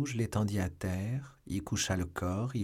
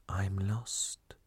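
hushed whisper, barely voiced; murmur; 5 dB quieter than usual. A narrator's reading. Doomed Milady to herself in English, murmuring. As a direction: murmur it under your breath. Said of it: "murmura en anglais Milady".

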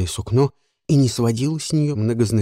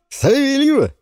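high, loud triumphant naming; excited announcement; 5 dB louder than usual. Acted. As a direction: present her like a prize. Жуан объявляет имя. (торжествующий возглас)

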